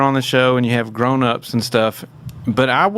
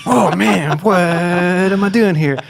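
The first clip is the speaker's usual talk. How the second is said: dismayed; 9 semitones higher, 4 dB louder, slower than usual. Retold or imitated. Self dismayed meeting rock star singer.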